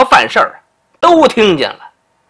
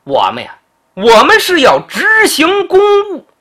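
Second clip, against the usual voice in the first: arrogant retort; 9 semitones higher than usual. Acted. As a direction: brush off the duke with a swaggering shout. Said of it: "这王志还挺横啊". (蛮横地高声顶撞)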